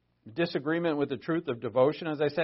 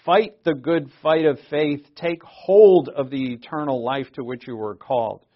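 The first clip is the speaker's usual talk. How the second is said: preaching; 10 dB louder than usual. Acted. Loud scripture reading.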